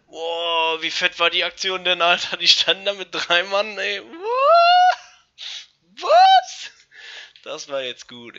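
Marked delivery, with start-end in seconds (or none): 0.1-4.0 loud, higher hyped praise; excited. Real, not acted. Hyped about a big play. 4.0-6.7 shrieking, much higher and louder disbelief; shocked. Real, not acted. Shocked at something in game.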